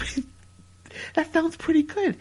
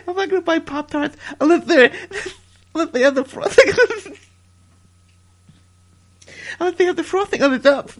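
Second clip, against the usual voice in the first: louder, breaking up with laughter; can't get the line out for laughing; laughing while quoting; 2 semitones higher, 4 dB louder than usual. Real, not acted. Cracks up while telling story.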